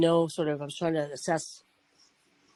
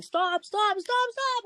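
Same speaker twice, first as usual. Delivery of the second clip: retold shouting; much slower than usual. Cyclist to driver pushing him under the car, mock shouting. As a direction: imitate panicked repeated shouting without real volume. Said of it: "I started to shout at him".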